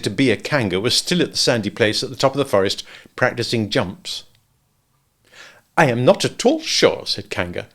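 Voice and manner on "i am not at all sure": pondering aloud in a higher voice; thoughtful. A narrator's reading. Kanga considering a cold bath for Roo, thoughtful. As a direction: muse it over, pitched up. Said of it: "in a thoughtful voice".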